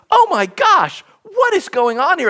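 high-pitched, scandalized outburst; mock indignation. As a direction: imitate flustered leaders, pitched well up. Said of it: "They're indignant".

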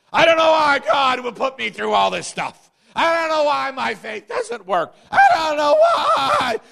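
high, loud, whining complaint; mock whining shout. Pastor mocking griping members to the congregation, high whining shout. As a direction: wail it like a griping churchgoer, shouting, climbing to a shrill peak. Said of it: "they'll blab that way to everybody else".

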